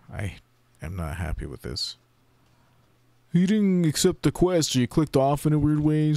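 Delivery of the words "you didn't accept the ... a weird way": high, loud mocking voice of the game; mock game message. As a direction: parody the smug quest system. Mocking the game's refusal.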